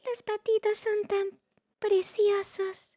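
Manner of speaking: put-on a small girl, delighted with the ducklings; high childlike voice, slowed; little girl voice. A narrator's reading. Emi, a little girl, speaks.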